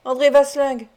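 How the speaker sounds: name him with restrained menace; understated dark warning; sombre voice. A narrator's reading. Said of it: "d'une voix sombre".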